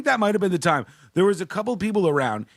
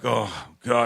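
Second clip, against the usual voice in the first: groggy fake-voice comic waking up; 6 semitones lower than usual. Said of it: "you wake up and you're like".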